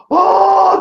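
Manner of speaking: high, long "oh" of horror; reenacted cry of alarm; shocked cry. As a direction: let it ring out high. Retold shock, bike in air.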